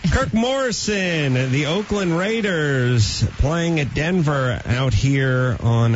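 slow, drawn-out announcer read. Acted. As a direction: announce it like a radio promo. Radio promo read.

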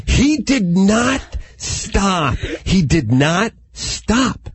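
slow "He did not stop", with a pause before "stop"; emphatic exasperation. Retold or imitated. Comic insists the farting was endless, "stop" set apart emphatically. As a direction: hammer out "did not" and "stop" slowly, word by word.

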